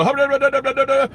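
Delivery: high-pitched gibberish yell; mock yelling. Customer proposes yelling at bored cashier, mock yelling. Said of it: "yell at you and go".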